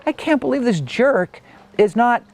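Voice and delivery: high-pitched, mildly exasperated disbelief; retold indignation. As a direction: replay your aggrieved complaint about an unsympathetic coach. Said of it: "like, angry and like".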